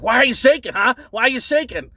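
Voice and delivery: jab the question at them like a bully; aggressive taunt. Retold or imitated. Clerk taunting a nervous customer, imitating his aggressive jeer. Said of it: "was being so aggressive".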